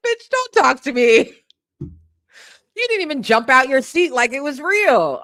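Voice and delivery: high, loud indignant voice; the offended dog snaps back; sassy high voice for an offended dog. Retold or imitated. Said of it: "He's like".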